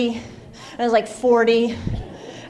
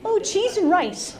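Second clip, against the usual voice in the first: higher, slow and prim; lightly voiced polite Utahn; a hint of a churchy do-gooder, drawn out; mock Utah minced oath; 7 semitones higher, slower than usual. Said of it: "you know, like".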